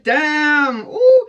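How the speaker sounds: high, drawn-out swoon; smitten exclamation. Retold or imitated. To herself on seeing him in the sun, awestruck.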